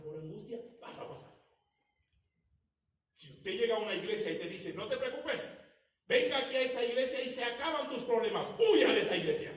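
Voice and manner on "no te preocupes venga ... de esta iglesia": a hint of a smooth false pastor, loud and higher; hammed-up promise of easy faith, laughing; mock false pastor. Pastor falso citado en sermón. (imitación burlona de un pastor falso)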